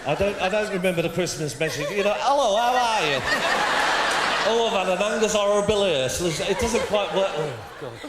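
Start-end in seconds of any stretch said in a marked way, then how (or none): 2.2-6.2 hammed-up posh royal English accent, higher and louder; a jokey British royal accent, playing the Queen; mock Queen impression. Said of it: "at least do the accent".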